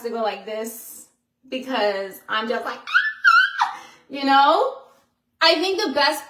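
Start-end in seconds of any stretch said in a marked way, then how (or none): 2.8-3.8 excited squeal. Retold or imitated. Reacting to good news.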